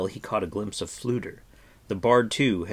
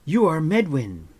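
louder, higher eager outburst; excited cry; 8 semitones higher, 7 dB louder than usual. A narrator's reading. Terran recognises the old hermit, excited cry. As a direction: blurt out the discovery. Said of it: "cried Terran".

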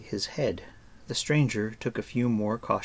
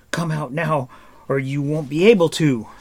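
raised, loud warning; shouted threat; 5 semitones higher, 7 dB louder than usual. A narrator's reading. Taran threatening the hiding stranger, shouting. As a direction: threaten him loudly. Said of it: "Terran shouted".

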